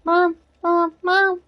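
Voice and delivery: put-on high insistent child calling; nagging kid. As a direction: tug at mom with each word. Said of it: "And he's like".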